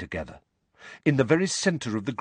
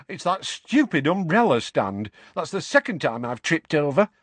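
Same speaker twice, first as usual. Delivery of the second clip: higher-pitched wailing excuse; Tonks, wailing; 6 semitones higher than usual. A narrator's reading. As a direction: bemoan your own clumsiness. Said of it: "wailed Tonks".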